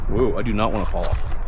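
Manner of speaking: ordinary delivery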